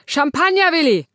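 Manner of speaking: high, loud barked order; snapping. Acted. Grumpy Martin snaps at Ella for champagne. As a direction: snap it at her like a grumpy patient.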